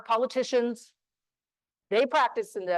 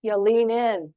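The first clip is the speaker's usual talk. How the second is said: ordinary delivery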